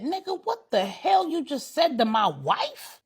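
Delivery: high-pitched, affronted challenge; outraged husband. A narrator's reading. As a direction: confront him over the insult to your wife. Husband confronts man flirting with wife.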